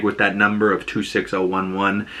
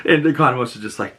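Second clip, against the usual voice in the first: usual speaking voice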